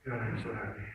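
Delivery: low, soft, downcast delivery at odds with the words; feeling sad. Shown as a demonstration. Coach has students repeat happy words while slumped, sad. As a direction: say it with shoulders down and no spirit. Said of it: "try to feel a little sad".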